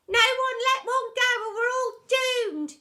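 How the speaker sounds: put-on high, loud, slow child voice; a cheeky pupil calling out; pupil shouting. A narrator's reading. Pupil in the packed room, shouting high. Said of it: "shouted someone".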